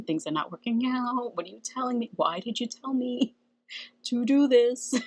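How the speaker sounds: laughing complaint. Retold or imitated. Complaining to her spirit guides.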